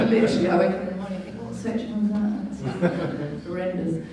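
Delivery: chuckling at her own nerdiness; laughing. Real, not acted. Speaker to viewers, laughing at her own legal obsession.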